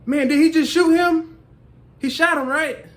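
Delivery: high, loud mock shock; startled viewer. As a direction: blurt it right after the gunshot. Imitating a viewer asking.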